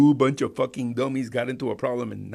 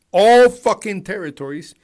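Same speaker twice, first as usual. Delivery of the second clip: suddenly much louder, higher on fucking territories; angry outburst; 8 semitones higher, 13 dB louder than usual. Real, not acted. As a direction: blow up in anger mid-sentence. Angry rant about citizenship.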